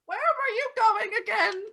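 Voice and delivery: put-on high, loud dotty old lady. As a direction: play a doddering old woman.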